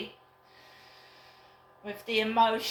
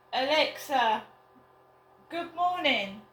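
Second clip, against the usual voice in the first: raised, higher voice to a device; loud and clear; 4 semitones higher, 4 dB louder than usual. Real, not acted. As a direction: call it out clearly across the room. Calling out to smart speaker.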